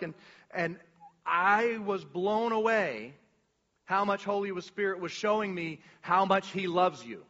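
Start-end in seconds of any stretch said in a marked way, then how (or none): none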